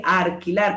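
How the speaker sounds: ALQUILAR said as arquilar; rhotacism, "arquilar". Shown as a demonstration. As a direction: put an R in place of the L in "alquilar". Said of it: "Pronunciación de la R en lugar de la L".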